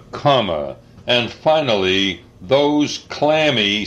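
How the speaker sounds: ordinary delivery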